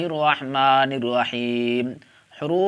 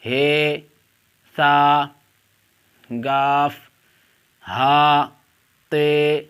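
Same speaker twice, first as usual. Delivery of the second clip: each letter called out loud and separate; alphabet recitation; 3 dB louder, much slower than usual. Shown as a demonstration. Teacher calling out Urdu letters to online students, loud and deliberate. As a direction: drill the letters slowly for the class.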